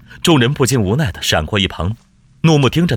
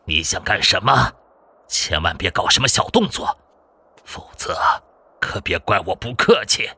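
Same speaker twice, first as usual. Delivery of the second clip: menacing warning. A narrator's reading. Hostage-taker warning a rescuer against tricks, menacing. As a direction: warn them off in a put-on villain voice. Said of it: "不禁警告道".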